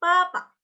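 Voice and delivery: "Papa" high, with unvoiced P sounds; correct P reading. Shown as a demonstration. Teacher repeating the right reading, high "Papa" with P. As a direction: repeat "Papa" emphatically with clear P. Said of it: "tapi papa".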